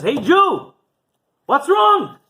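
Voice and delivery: loud, high, hearty holler; big imperious shout; Baron calling out. Acted. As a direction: boom it from a passing carriage. Booming Baron hails weeping man.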